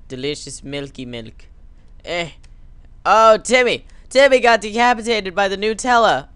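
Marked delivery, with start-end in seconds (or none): 3.1-6.3 loud, high-pitched wail; mock anguish. Dad crying out over decapitated Timmy, mock anguish. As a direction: wail it in hammed-up grief.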